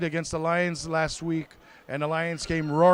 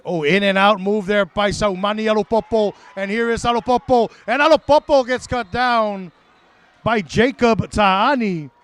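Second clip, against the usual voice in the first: loud, raised big-play call; excited play-by-play; 4 semitones higher, 7 dB louder than usual. Real, not acted. Commentator calling a runner's move and tackle, excited. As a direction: build louder and higher through to the tackle.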